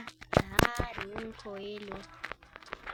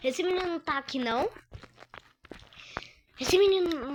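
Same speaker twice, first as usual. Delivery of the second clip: calling out; 6 semitones higher, 6 dB louder, much faster than usual. Real, not acted. Calling for absent friend.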